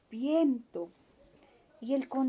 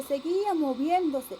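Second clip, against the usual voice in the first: ordinary delivery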